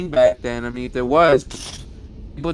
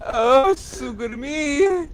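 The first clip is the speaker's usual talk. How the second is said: mock swooning; 8 semitones higher, slower than usual. Swooning over character name.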